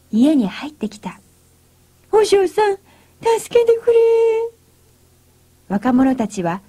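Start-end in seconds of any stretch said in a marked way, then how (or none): 2.1-4.0 high, loud, frightened cry for help; cling and beg in panic; desperate plea. A narrator's reading. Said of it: "足に取りすがって泣いた". (必死にすがる高い声)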